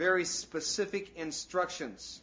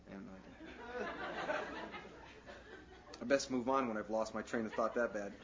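laughing at himself; 5 semitones lower, 5 dB quieter than usual. Real, not acted. Laughing at losing his thread.

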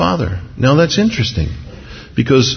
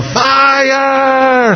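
high, drawn-out cry of fire; mock yell of alarm; 13 semitones higher than usual. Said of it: "and you yell".

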